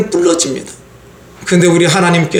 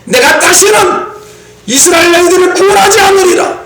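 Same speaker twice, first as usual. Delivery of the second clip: stern divine warning; 8 semitones higher than usual. Acted. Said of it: "하나님은 이스라엘에게 이렇게 말합니다". (화난 하나님의 엄한 선포)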